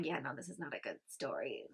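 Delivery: low, soft offhand voice; dismissive detective. Retold or imitated. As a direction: brush it off like a bored cop.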